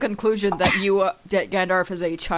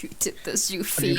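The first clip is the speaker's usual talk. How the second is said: hissed whisper, you fiend; whispered accusation; 6 dB quieter than usual. Acted. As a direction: whisper the blame at them. Same accusation repeated whispered.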